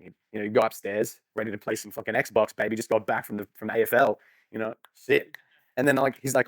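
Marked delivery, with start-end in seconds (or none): none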